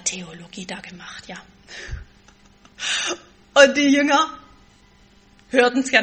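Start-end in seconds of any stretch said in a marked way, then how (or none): 3.5-6.0 louder, higher "die Jünger"; preacher stressing the disciples; preaching emphasis. Real, not acted.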